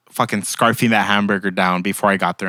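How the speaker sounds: ordinary talk, nothing marked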